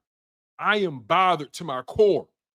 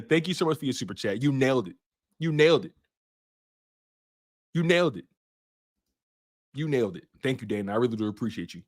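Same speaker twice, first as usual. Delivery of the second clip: lower, softer thanks; grateful thanks; 8 semitones lower, 4 dB quieter, faster than usual. Real, not acted. Thanking a super chat donor.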